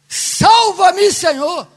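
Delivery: loud, high plea that eases at the end; clamor. Acted. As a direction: cry it out to heaven. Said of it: "É esse clamor que Deus quer ouvir". (clamor gritado a Deus)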